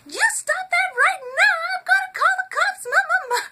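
very high, loud, harsh yelling; mock ferocious mom screaming, fast at the end; horrible ugly scream. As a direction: scare the boys off with an ugly shriek. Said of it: "I made my voice horrible".

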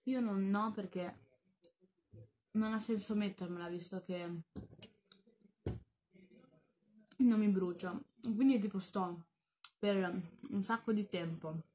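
muffled speech between bites; talking while chewing. Real, not acted. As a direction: mouth full of food. Seen 0: Puts beans in mouth at 0.8, chews through later frames.

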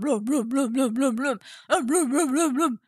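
low, rhythmic cartoon munching noises; pretend gobbling. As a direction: mimic greedy eating with bubbly syllables. (yalandan lüp lüp yeme sesi)

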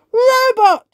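very high, loud shout. Acted. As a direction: call the robot sharply. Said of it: "shouts Ben".

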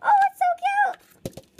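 high, loud delighted squeal; excited squeal. Real, not acted. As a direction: gush over it. Opening new washi tape.

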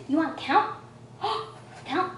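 excited coaxing. Acted. Mom coaxing toddler to count.

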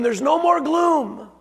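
preacher's high slow cry of hope; proclaiming. Acted. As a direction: call it out over the congregation.

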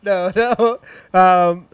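usual speaking voice

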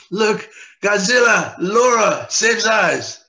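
loud, high mock Japanese monster-movie accent; mock sushi accent. Patient hamming up a Japanese accent for his therapist. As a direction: bellow it like a hammed-up Japanese movie dubber. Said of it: "that was my, uh, sushi accent".

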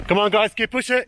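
loud, high encouraging yell; rallying shout. Real, not acted. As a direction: push them on with a shout. Shouting to marchers.

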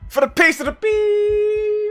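slow, high shouted war cry; battle cry. Pretend. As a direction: bellow it like a dying knight. Mock rallying cry while dying.

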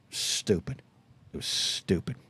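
half-whispered, under the breath; self-scolding aside. Real, not acted. Host scolding himself about the donuts, half-whispered. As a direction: mutter it to yourself, ashamed.

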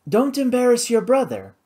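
high, poised feminine voice; mildly reprove, pitched high; cool reproach. A narrator's reading. Karina chiding Flora about her brother, coolly. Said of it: "Karina said coolly".

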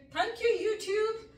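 grateful burst. Real, not acted. Gratitude burst.

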